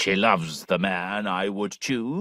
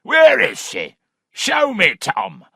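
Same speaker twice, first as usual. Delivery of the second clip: high, loud eager demand; hearty squire wanting to see her; 11 semitones higher, 6 dB louder, slower than usual. A narrator's reading. Weston eager to see the girl.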